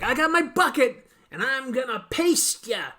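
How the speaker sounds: high, loud cartoon villain; squeaky menacing threat. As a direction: ham up a gloating cartoon baddie. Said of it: "And he's like".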